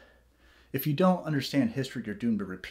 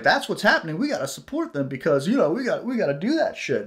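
higher, louder parroting of a brainwashed viewer; mock gullible viewer; 6 semitones higher, 4 dB louder than usual. Mocking TV-news believers, higher and louder. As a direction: mimic someone who swallowed the news whole.

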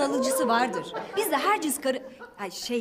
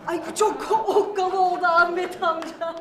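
laughing; 4 semitones higher, 4 dB louder than usual. Real, not acted. Host laughs at old man's joke.

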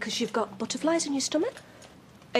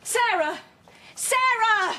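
sharp, raised call, rising higher on the repeat; calling after someone; 8 semitones higher, 3 dB louder, much slower than usual. Acted. Mother calling after her departing daughter, urgent raised call.

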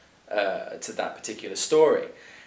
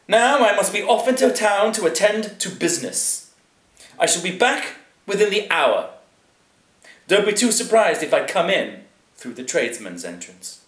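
high, loud aristocrat taking his leave; pompous lord; 9 semitones higher, 6 dB louder than usual. A narrator's reading. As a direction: announce the departure grandly to the servant. Plays Lord Chatterley in a story.

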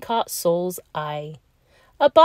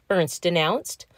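ordinary delivery, nothing marked

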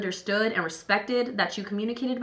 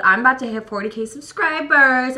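excited; 4 semitones higher, 8 dB louder than usual. Real, not acted. Excited subscriber announcement.

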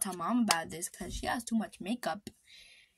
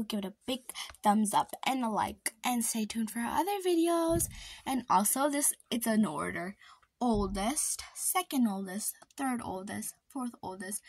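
ordinary talk, nothing marked

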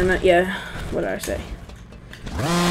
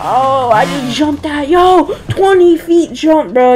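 excited; 7 semitones higher, 5 dB louder than usual. Real, not acted. Hyped about landing a jump.

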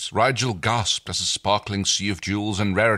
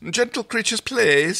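put-on Stark's accent; 13 semitones higher, 4 dB louder than usual. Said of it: "Stark interrupted".

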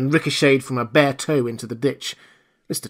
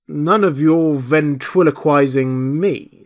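loud, slow, raised indignation; indignant challenge; 4 semitones higher, 5 dB louder, slower than usual. A narrator's reading. Marvel to the hidden speaker, indignant. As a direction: drag it out as a rebuke.

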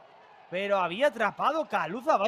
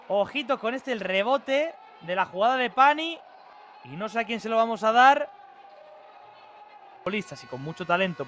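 ordinary delivery

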